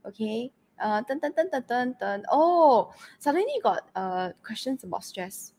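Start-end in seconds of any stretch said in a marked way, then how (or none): none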